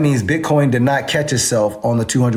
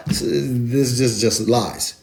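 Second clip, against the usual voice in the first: usual speaking voice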